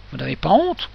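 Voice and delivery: plain reading voice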